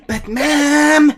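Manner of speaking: whiny cartoon kid, high and loud; Cartman whine. Cartman impression after nerd voice.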